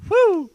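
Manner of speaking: high, loud whoop; loud, high-pitched imitated hoot; imitated "whoo". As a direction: mimic a game character's sudden whoop. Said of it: "he just goes".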